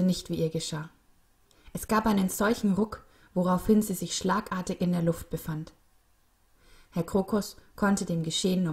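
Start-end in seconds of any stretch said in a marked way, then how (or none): none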